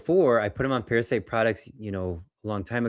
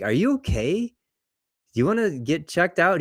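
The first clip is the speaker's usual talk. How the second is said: higher, lightly voiced worried nurse; concerned nurses; 8 semitones higher than usual. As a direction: mimic the nurses checking on him, slightly slowed. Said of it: "the nurses are like".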